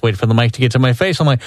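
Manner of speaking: usual speaking voice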